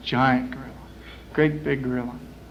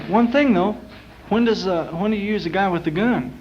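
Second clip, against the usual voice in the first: curious query; 6 semitones higher than usual. Acted. Curious client questions the catcher.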